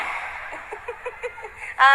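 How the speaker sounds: short unvoiced high laugh; quick airy chuckle; breathy laugh. Real, not acted. Laughter at the joke.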